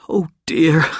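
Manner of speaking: a touch of distress, held in; boy starting to break down, mildly upset. A narrator's reading. Wart near tears.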